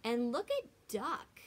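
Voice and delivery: ordinary delivery, nothing marked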